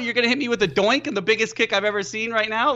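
high, incredulous protest, retold; disbelief. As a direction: replay your stunned what-are-you-doing outburst. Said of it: "And I'm like".

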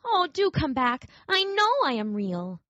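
a touch of sorrowful pleading; pleading little rabbit. Acted. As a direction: beg them to return.

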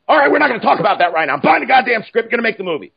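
high, very fast brush-off; hyped-up producer. Retold or imitated. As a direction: rattle it off, overruling everyone. Peters brushing off the dog argument.

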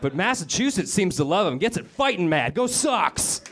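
hammed-up Boston accent; mock Boston fan. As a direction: cheer like a rowdy Boston local. Mocking Massachusetts fan.

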